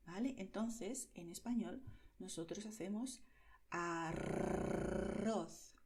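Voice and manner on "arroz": arroz with a long trilled rr; rolled rr. Shown as a demonstration. Teacher demonstrating the Spanish R, rolled rr. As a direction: roll the tongue hard on arroz. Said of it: "La lengua tiembla".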